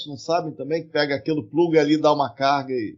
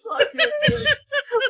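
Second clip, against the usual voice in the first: squeaking through laughter; can barely speak for laughing; helpless laughter; much slower than usual. Real, not acted. Breaks up laughing after joke.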